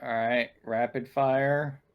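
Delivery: ordinary talk, nothing marked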